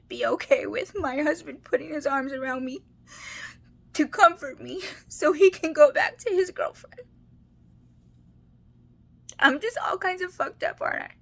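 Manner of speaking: fighting back real tears, laughing through them; choked-up, tearful voice; crying. Real, not acted. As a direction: voice high and breaking with tears. Tearful confession about her husband.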